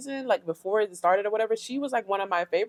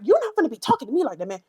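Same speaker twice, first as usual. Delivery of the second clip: higher, quick mock tough talk; acting tough; 5 semitones higher, faster than usual. Vlogger mocking Akbar's confrontation, put-on tough talk. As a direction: imitate her trying to get buck, lightly exaggerated. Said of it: "every time she starts talking and try to act tough".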